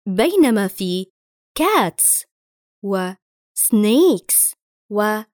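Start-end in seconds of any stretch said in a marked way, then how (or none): 1.6-2.2 cats ending in a sharp hissing s; plain s. Shown as a demonstration. Teacher shows the plural ending to the class, cats with s. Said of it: "ينطق حرف ال S كما هو". 3.6-4.5 snakes ending in a hissing s; plain s. Shown as a demonstration. Teacher shows learners the plural ending, snakes with s. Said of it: "ينطق حرف ال S كما هو".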